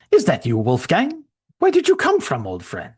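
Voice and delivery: a shocked friend seeing a ghost; startled old friend. Acted. Gerstein startled by Shrek's sudden appearance, surprised voice.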